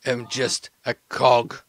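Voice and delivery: halting, word-by-word delivery; weary, deliberate self-excuse; slow, measured deflection. Acted. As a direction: spell it out slowly with pauses. Associate deflects the blame.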